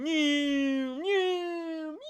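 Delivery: long, high, drawn-out whine of a playing child; high-pitched kid noise. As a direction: imitate a kid zooming around, held very high. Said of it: "the brother is going".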